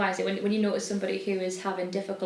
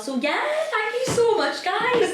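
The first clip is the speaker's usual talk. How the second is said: high, louder squeaky farewell; squeaky sign-off; 6 dB louder than usual. Pretend. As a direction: squeal a cutesy goodbye. Mock sign-off.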